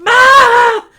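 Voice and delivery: put-on loud high gasp into high kid voice; startled boy Aaron. Boy looking at man's behind, startled high voice. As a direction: react aghast, high-pitched. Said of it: "said Aaron".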